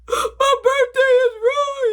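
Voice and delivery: high, slow sobbing complaint; crying child. Retold or imitated. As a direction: sob it out like a kid whose day is ruined. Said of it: "You know, I'm crying".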